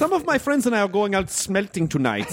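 fetish German. Pretend. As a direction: invite them in a sly German accent. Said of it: "It's fetish German".

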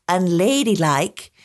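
slow, drawn-out "unladylike" in scare quotes; ironic quote marks. Real, not acted. Host mocking a Victorian label, ironic drawl.

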